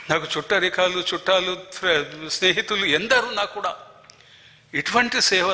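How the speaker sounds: rising fervour. Real, not acted. Rising emotion listing relatives.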